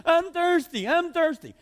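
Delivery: high-pitched mimicry of thirsty kids; high, hammed-up child's complaint; mock whining kids. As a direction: imitate kids' cries in a high voice. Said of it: "the kids will scream".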